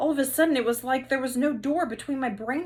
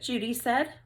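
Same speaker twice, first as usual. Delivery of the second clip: ordinary delivery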